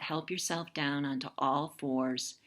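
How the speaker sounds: ordinary delivery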